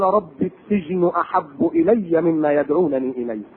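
slow, low chanted verse. Acted. Preacher recites the verse low and slow, thinking of the afterlife.

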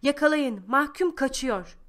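guards shouting. A narrator's reading. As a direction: guards yell after the runaway child. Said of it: "diye bağırışıyorlar".

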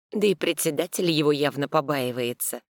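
ordinary delivery, nothing marked

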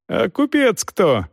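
high, stretched angry question; yelling. A narrator's reading. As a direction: bawl the question, drawn out. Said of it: "орал Пруф".

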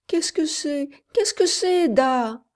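put-on high-pitched child's tearful question; crying little girl. A narrator's reading. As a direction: plead like a scared little girl. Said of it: "pleurait la petite fille". (petite fille qui pleure)

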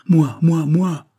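high, loud volunteering shouts; eager cries. A narrator's reading. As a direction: cry out as men eager to be picked. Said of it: "s'écrièrent néanmoins". (s'écrier avec empressement, fort et aigu)

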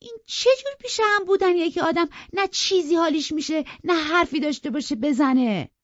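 high, loud complaining; irritated girl grumbling at him. A narrator's reading. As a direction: nag and scold him. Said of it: "با غرغر گفت".

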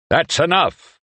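high, loud commanding bark. Acted. Chadro orders the brawling men to stop, barked command. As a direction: stop the fight with one sharp order. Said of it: "barked Chadro".

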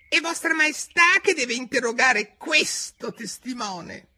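very high, slow little rabbit voice. A narrator's reading. Rabbit discreetly prompts the king, high confidential voice. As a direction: squeak a confidential prompt to royalty. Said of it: "che disse a bassa voce".

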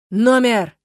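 loud barked demand; shout. A narrator's reading. Stas shouts into the phone, loud. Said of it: "кричал он в трубку".

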